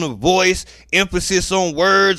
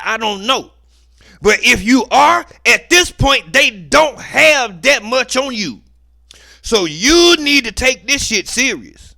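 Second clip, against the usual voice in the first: stern warning; 6 semitones higher, 6 dB louder than usual. Retold or imitated. Voicing the judge's hidden warning.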